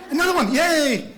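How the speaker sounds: loud, high little cheer; mock celebration. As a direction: celebrate a trivial win too much. Caller celebrates finishing a call.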